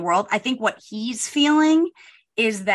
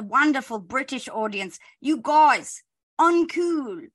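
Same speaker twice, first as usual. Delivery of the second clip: mock British accent; 3 semitones higher, slower than usual. Speaking for British listeners scolding the show, mock British accent.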